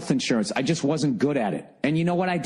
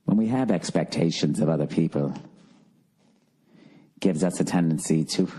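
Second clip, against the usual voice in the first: low mock-British meditation voice, unhurried; soothing British guide; 7 semitones lower than usual. Comic mimicking a lesson on patience, soothing British accent. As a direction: imitate a soothing British teacher, voice dropped low. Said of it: "soothing voice like this".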